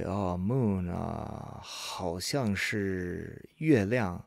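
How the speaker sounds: slow, hesitant searching for a word; thinking it out, tentatively; unsure recall. Acted. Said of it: "They'll say it that way".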